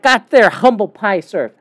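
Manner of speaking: ordinary talk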